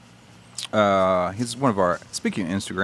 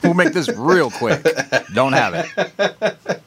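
laughing; 7 semitones higher, slower than usual. Real, not acted.

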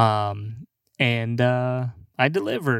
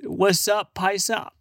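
high, cocky mock-bro greeting; overfamiliar American bro; 8 semitones higher, slower than usual. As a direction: greet him like a clueless party bro. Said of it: "Don't call a Mexican paisa if you don't know him".

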